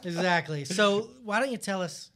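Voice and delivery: ordinary talk, nothing marked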